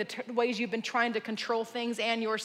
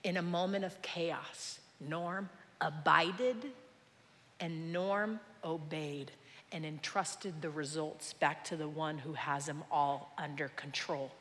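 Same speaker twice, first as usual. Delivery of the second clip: low, soft, reflective sermon voice; hushed preaching; 6 semitones lower, 10 dB quieter than usual. Real, not acted. Preacher confessing his need for control, softly. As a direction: bring it right down and confide in them.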